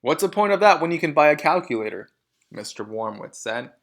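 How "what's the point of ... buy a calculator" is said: fast, loud scoff; loud scoffing dad; dismissive father. A narrator's reading. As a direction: rattle off the scornful question. Mr Wormwood dismissive.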